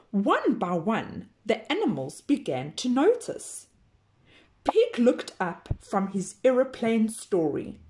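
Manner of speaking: ordinary delivery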